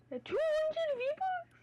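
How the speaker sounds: high, slow stunned question; shocked question. Real, not acted. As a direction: squeak it in disbelief. Reward reveal.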